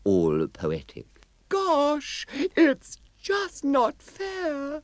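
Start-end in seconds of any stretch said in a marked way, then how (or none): 1.5-4.8 very high, slow sulky fairy; sad fairy voice. Acted. Mavis fairy voice.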